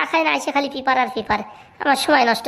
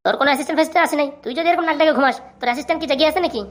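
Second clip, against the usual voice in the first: usual speaking voice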